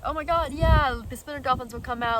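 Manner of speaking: loud, high burst of enthusiasm, quick; excited agreement. Retold or imitated. To her host about seeing dolphins, loud excited gushing. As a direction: gush over the dolphin plan. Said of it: "I was like".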